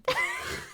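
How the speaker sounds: high laugh. Real, not acted. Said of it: "Why did you say it like that".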